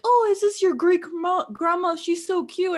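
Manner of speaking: high, bright mock neighbor gushing; neighbor's voice. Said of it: "my neighbors were like".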